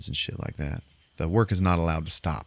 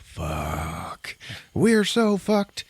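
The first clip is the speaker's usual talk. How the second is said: exasperated, voice leaping up on the second phrase; mock despair; 3 semitones higher, much slower than usual. Film gunslinger watching hopeless trainees, mock despair.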